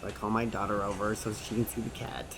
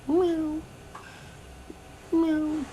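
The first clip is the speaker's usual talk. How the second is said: high, plaintive mock meow; imitating a crying cat. Sad cat stuck in a tree, high mock meowing. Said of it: "Just crying and crying".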